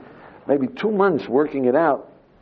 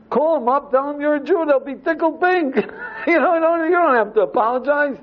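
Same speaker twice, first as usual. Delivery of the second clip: excited urging; 13 semitones higher than usual. Retold or imitated. Rabbi urging Jim excitedly.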